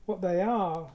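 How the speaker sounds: usual speaking voice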